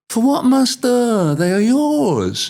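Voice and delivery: high, slow pleading servant; protesting servant. Acted. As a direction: plead with the master to keep them.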